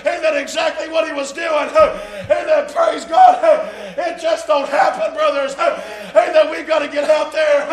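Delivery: loud, high-pitched revival preaching with driving cadence; full-force preaching. Real, not acted. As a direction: shout it to the congregation in punched bursts.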